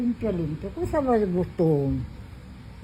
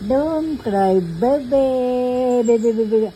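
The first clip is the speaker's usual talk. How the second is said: high soothing coo; 4 semitones higher, 6 dB louder than usual. Real, not acted. Soothing a fussing baby.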